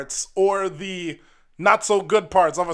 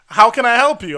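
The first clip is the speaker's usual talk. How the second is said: chirpy clerk; 6 semitones higher, 6 dB louder, faster than usual. Retold or imitated. Rental clerk voiced in story.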